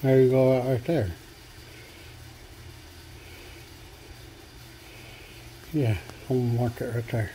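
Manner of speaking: talking around a marker held in the teeth; words slurred by a pen between the lips; marker in mouth. Real, not acted. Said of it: "I got a marker in my mouth".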